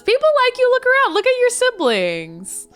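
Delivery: reassuring. Pretend. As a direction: lift him up with a high, eager voice. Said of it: "Chelsea says".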